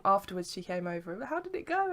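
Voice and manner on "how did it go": high-pitched imitation of a friendly woman; friendly fellow visitor. Lady checking after the photo shoot, imitated friendly high voice.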